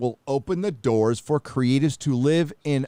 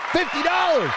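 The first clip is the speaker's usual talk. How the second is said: big hype shout, much higher; announcer shout. Acted. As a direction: call it out like a game show host. Calling out donation amount.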